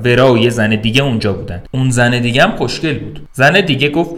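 usual speaking voice

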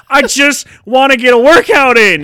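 high, loud exasperated plea. Retold or imitated. Gym-goer pleading for the bench, loud and exasperated. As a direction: push it up high and loud, fed up. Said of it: "And you're like".

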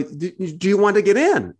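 lightly voiced eager local asking them in; imitating local man. Palestinian man across the street offering entry, lightly imitated slow call.

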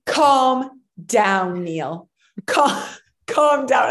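hammed-up slow shouted calm down; mock wife. Imitating the wife scolding Neil, slow mock shout. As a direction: imitate her snapping calm down. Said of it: "and this is you".

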